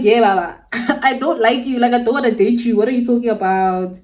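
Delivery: loud, incredulous brush-off; exasperated rejection. Retold or imitated. Replaying her refusal to the boy begging a chance, exasperated. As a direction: act out telling someone off loudly. Rejecting his plea in retold quarrel.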